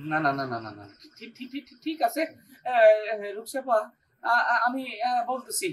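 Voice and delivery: low, stuttering, cowed agreement; nervous stammering. Acted. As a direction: stumble over repeated words as you back down. Threatened employee gives in, stammering.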